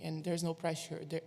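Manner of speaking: ordinary talk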